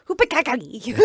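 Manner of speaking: garbled mock Chinese accent name; a hammed-up fake Chinese accent. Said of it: "say it with a Chinese accent".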